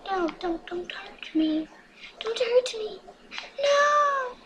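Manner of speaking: pleading, climbing to a squeal; mock pleading. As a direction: beg for mercy, playfully hammed. Pleading in-game character pretend.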